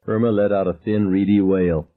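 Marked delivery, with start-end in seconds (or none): none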